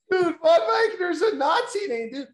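high, loud, worked-up claim; insist, getting all worked up; excited. Real, not acted.

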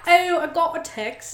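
slow, high Cockney imitation; drawn-out mock Cockney line; Cockney accent. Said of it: "The Cockney accent?".

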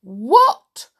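loud, high, outraged witch; witch crying out. A narrator's reading. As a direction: shock turning to fury. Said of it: "cried the witch".

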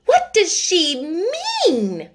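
the headmistress demands an answer, outraged; indignant cry. Acted. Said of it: "cried Miss Minchin".

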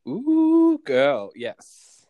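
ordinary delivery

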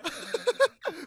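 a loud, high cackle; high burst of laughter. Real, not acted. Comedian cackles loudly at a silly name.

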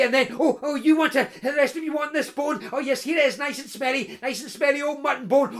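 high, jittery coaxing of scary dogs; man nervously calling nice doggy to hounds. A narrator's reading. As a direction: coax the dogs while backing off in fear. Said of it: "He backed away fearfully".

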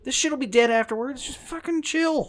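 high, loud, drawn-out orders; exasperated yelling. Pretend. Yelling at a character to stay in the locker, exasperated. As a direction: shout comic advice at the screen.

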